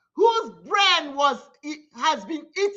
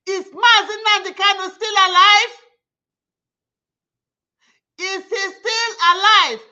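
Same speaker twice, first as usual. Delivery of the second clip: 1 raised demanding voice; 6 dB louder than usual. Real, not acted. Demanding whether the leader lives.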